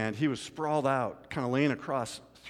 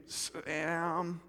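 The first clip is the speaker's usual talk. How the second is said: soft groaning mumble; groaned answer; 3 semitones higher, 8 dB quieter than usual. Retold or imitated. Sprawled man answering the officer, groaning. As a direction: groan the name out. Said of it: "he groans out".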